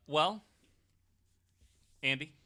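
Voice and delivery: ordinary delivery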